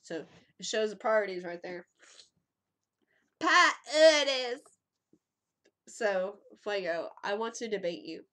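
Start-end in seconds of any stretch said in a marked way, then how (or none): 3.4-4.6 slow, loud word through laughter; laughing at the punchline, slow and loud. Real, not acted. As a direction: drag it out laughing.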